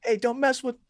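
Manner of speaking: high-pitched hammed-up Italian mobster; bad Italian accent. Playing a Sopranos mobster defending Tony, bad Italian accent. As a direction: warn like a cartoon Italian mafioso. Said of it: "I can't do a good Italian accent".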